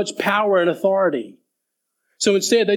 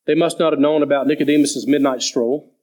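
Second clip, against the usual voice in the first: ordinary talk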